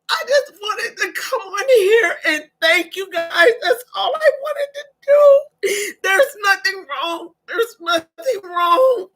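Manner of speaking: real grateful crying; overwhelmed and tearful; tears of joy. Real, not acted. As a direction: talk through happy sobs. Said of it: "They're tears of joy".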